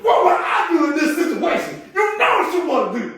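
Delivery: high, loud, shouted devil voice; little devil. Retold or imitated. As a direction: bark the temptation like a cartoon devil. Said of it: "the little devil himself".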